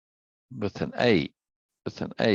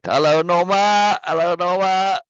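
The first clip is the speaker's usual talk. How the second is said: calling out greeting; 9 semitones higher, 8 dB louder than usual. Real, not acted. Streamer greets Norma as she joins, calling out. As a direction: sing out a delighted welcome, much higher and louder. Greeting a viewer who just joined.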